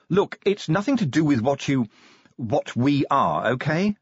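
lightly voiced sergeant pressing the point; impatient sergeant. Voices Angua answering Sally.

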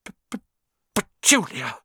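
stuttering, breaking out into a high cry; gasped. A narrator's reading. Uncle shocked by the letter, gasping to his wife. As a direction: stammer on the first sound, then burst out. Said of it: "he gasped".

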